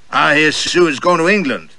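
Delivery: a pompous gentleman sounding off at the next table; loud Southern gentleman. Acted. Gentleman at the next table to his companion, blustering high and loud. Gentleman's voiced dialogue at dinner.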